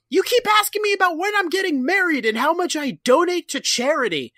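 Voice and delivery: high, put-on whiny voice; mock whiny complaint. Barney whining at Floyd about nosy questions. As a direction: whine it like a put-upon friend.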